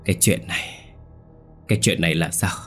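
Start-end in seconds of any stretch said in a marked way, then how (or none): 0.0-0.9 breathless, barely-there words; faint dying whisper. Acted. Wife to husband, horrified over the body, whispering. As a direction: whisper it like someone about to die. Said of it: "hỏi bằng một giọng thều thào như người sắp chết".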